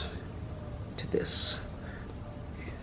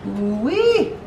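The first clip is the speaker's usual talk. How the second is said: delighted whoop; 6 semitones higher than usual. Real, not acted. Delighted by the cooked food.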